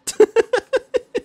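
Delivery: high, loud burst of laughter; real laugh. Real, not acted. Gamer amused at own evil accent theory, laughing. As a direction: crack up in a high rapid laugh.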